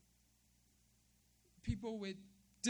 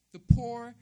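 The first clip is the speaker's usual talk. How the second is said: forceful stress on poor; 3 semitones higher, 15 dB louder than usual. Real, not acted. Preacher stressing the needy.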